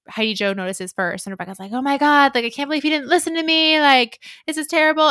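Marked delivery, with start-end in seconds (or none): 1.7-5.1 higher, louder exasperated retelling; imitated aghast complaint, pitched up; acted dismay. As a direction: play her upset, voice raised. Acting Rebecca's dismay at news.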